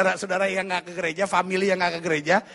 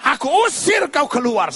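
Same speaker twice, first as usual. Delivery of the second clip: high, forceful exorcism command; loud, high-pitched rebuke; commanding shout; 5 semitones higher, 5 dB louder than usual. Acted. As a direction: order the demon with full authority. Acts believer commanding a demon.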